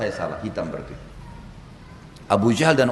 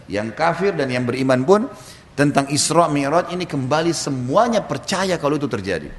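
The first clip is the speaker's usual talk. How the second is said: ordinary talk, nothing marked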